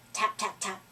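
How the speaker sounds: plain reading voice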